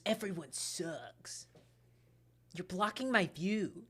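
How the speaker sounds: put-on slow, soft, sullen teen mutter; a bored, moody boy brushing someone off; Neku. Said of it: "That's Neku at the beginning".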